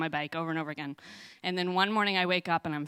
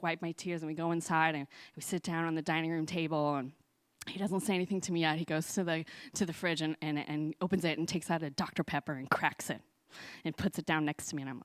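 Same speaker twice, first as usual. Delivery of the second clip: normal talking voice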